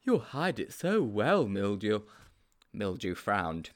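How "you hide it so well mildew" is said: slow, impressed character voice; Sponge, admiring. A narrator's reading. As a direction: savour the compliment to a cleverer friend.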